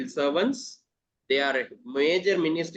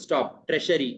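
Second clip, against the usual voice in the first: ordinary talk, nothing marked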